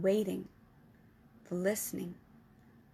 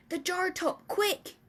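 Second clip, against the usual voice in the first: higher, urgent ask; urgent plea; 9 semitones higher, slower than usual. Acted. Giant asking Sophie for the jar top, urgently. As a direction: press her to hurry.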